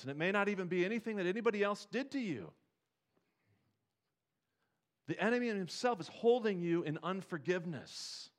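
high, loud, urgent preacher push; fervent preaching. Acted. Pastor pleading with the congregation: loud, high, fervent. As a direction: preach it to the back row.

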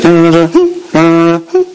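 sung dun-dun notes climbing up high; imitated rising harmonica riff. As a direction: mimic the harmonica climbing up the keys. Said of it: "It just keeps getting higher and higher".

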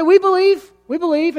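eager crowd. Acted. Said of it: "And so we say".

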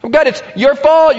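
high, loud whiny "fault"; pouting blame. Acted. Jonah pouting at God outside the city, loud and whiny. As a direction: whine the blame like a sulking child. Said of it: "he's pouting and he's saying".

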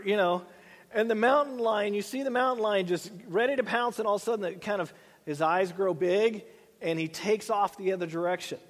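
ordinary talk

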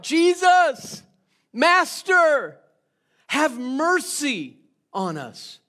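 loud, high desperate cry from afar; loud pleading shout. Acted. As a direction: call out across a distance, begging. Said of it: "these ten lepers then cry out".

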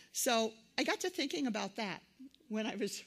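ordinary delivery

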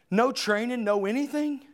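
normal talking voice

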